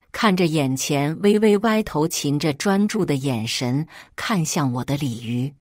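usual speaking voice

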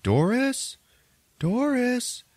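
high calling Doris. Retold or imitated. Family searching the dark house, calling out high. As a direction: call up the stairs in a high voice.

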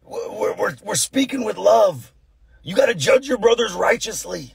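high, strained self-righteous protest; hammed-up righteous indignation; mock angry zealot. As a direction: parody someone scolding with fists balled up. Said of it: "Their fists are balled up".

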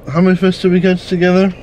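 ordinary talk

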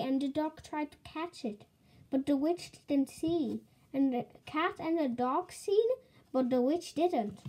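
ordinary delivery, nothing marked

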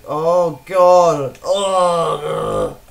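loud groan of dismay; exasperated outburst. Real, not acted. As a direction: cry out and groan at the lag. Game lags at bad moment.

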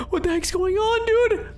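put-on scared kid crying out; panicked cry. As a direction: whine it in fright.

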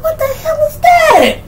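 very high-pitched mock shriek; appalled outcry. Acting out a whiff of the walking death, high appalled squeal. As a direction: squeal it in exaggerated disbelief.

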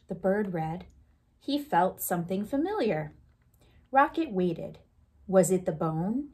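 put-on lively read-aloud for children; storytime reading. Teacher reading a picture book aloud to the class, lively storytime voice.

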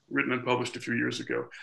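ordinary delivery

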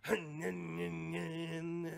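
imitated dead-battery moped drone; drawn-out stuttering wordless groan; sputtering moped moan. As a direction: mimic an engine failing to start. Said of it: "sounds like he's trying to start a moped".

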